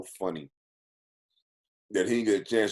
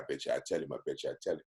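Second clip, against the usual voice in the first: low-voiced bet, softer; quiet scheming boast; 6 semitones lower, 10 dB quieter, much faster than usual. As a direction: murmur the dare with a hint of mischief. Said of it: "he said".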